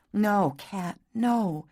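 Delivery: ordinary delivery, nothing marked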